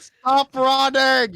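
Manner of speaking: high, loud, slow yell; Mage Hand shout. Acted. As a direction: bellow at them to stop. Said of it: "You hear Mage Hand shout".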